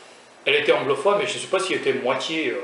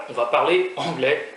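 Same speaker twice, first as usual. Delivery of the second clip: usual speaking voice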